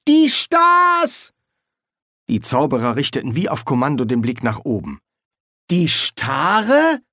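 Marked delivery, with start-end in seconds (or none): 0.1-1.3 very high, loud cry; excited shout. A narrator's reading. Boy tells dean who is coming, shouting excitedly. 5.7-7.0 high rising question; puzzled echo. A narrator's reading. Dean mishears the boy's stars as starlings, puzzled.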